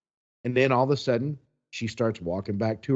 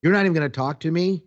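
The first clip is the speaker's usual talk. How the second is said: picking a fight; 5 semitones higher, 5 dB louder, faster than usual. Retold or imitated. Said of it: "she goes".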